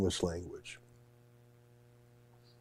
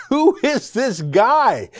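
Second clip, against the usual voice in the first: much higher, slow, louder delighted question; thrilled amazement; 12 semitones higher, 5 dB louder, much slower than usual. Retold or imitated. Reliving his first sight of Andrew Neil, asking in high, delighted awe. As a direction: gasp it out like you've found a star. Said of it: "and I thought".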